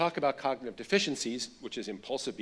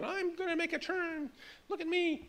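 put-on much higher, eager little girl showing off; little daughter voice. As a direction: call out like a proud five-year-old. Daughter calls from her scooter.

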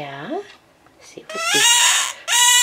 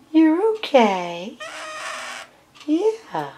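soft, reassuring coo to a frightened bird; calm him down gently; higher, gentle voice for a scared pet; soothing pet talk; 7 semitones higher, 4 dB quieter than usual. Real, not acted.

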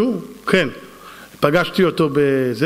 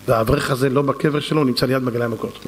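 ordinary talk, nothing marked

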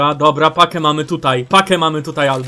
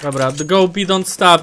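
ordinary delivery

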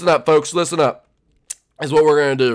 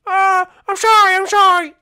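very high squeaky loud voice; frantic apology; 4 dB louder than usual. Acted. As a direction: squeal sorry to the sergeant. Guilty recruit apologizes to sergeant.

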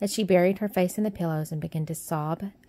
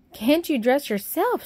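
higher, slowed-down astonished question; gasping amazement; 8 semitones higher, 4 dB louder, slower than usual. A narrator's reading. Maid astonished at Mary, gasping disbelief. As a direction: disbelief at a girl who can't dress. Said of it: "she gasped".

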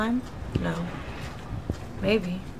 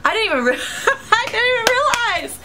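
laughing; 12 semitones higher than usual. Real, not acted. Laughs at own mistake.

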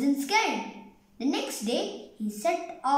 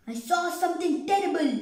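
higher-pitched, frightened exclamation; a touch of panic in the telling; alarmed cry; 7 semitones higher than usual. A narrator's reading. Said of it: "he cried to his wife and son".

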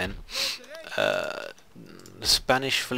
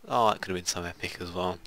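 ordinary talk

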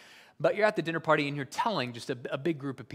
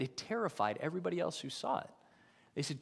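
ordinary talk, nothing marked

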